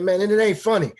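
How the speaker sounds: high, loud indignant outburst; retold yelling. Player to laughing head coach, yelling indignantly. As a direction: yell it in protest. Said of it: "yelling at a head coach".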